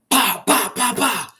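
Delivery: loud high mock slap sounds; slap noises. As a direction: smack out each pow like a hand hitting. Imitating slaps on coffee cups.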